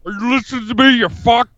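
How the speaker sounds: hammed-up tough-guy politician impression; comic strongman bluster; Jesse Ventura. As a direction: declare it like a blustering off-grid candidate. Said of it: "Jesse, is your neighbor coming in your house".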